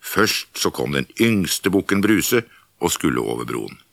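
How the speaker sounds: ordinary delivery, nothing marked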